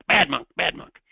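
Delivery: high, loud mock scolding; angry monk. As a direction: nag like an outraged monk in a tale. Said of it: "The other monk is angry".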